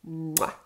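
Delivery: a big high smacking kiss sound; loud kiss smack. Acted. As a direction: plant a noisy kiss. Shimmer fish kisses him.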